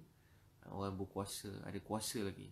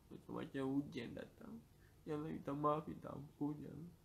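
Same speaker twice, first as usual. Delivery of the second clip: pleading prayer that climbs higher, lines fading at the ends; tearful prayer; 3 semitones higher than usual. Real, not acted. Preacher praying for the congregation, tearful pleading prayer. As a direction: pour out sadness and tiredness to God. Heartfelt prayer, voice rising with sorrow.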